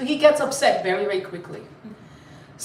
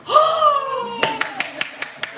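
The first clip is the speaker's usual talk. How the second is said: long high-pitched gasp; mock amazed gasp; 13 semitones higher than usual. Teacher reacting to a child's answer, amazed gasp. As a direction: gasp hugely in astonishment.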